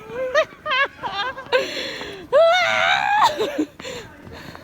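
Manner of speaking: high squealing laugh burst; shrieking laugh. Real, not acted. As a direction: let out a loud squealing laugh. Laughing in the snow.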